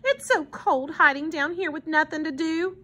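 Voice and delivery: put-on high-pitched little boy's complaint; lightly voiced childish plea; pleading child. A narrator's reading. Paul complaining of the cold, lightly pleading.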